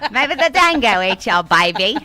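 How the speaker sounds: loud, hammed-up Aussie accent; attempted Australian accent. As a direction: belt out a bad Australian impression. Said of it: "I can't do the accent".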